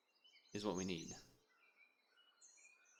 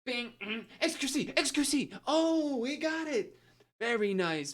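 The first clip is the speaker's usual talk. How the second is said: high, slow, booming quiz host; game show host; 9 semitones higher, 4 dB louder, slower than usual. Retold or imitated. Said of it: "like a game show host voice".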